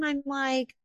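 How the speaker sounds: ordinary talk, nothing marked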